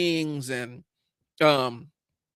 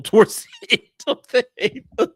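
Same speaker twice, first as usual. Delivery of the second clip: laughing; 9 semitones higher, 8 dB louder, slower than usual. Real, not acted. Cracks up at own remark.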